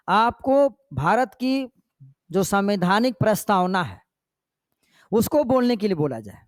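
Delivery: normal talking voice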